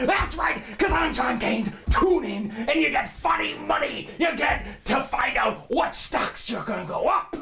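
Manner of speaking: screaming, high-pitched stock guru pitch; manic money show host. Acted. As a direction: sell it like a frantic TV finance host. Host voice returns.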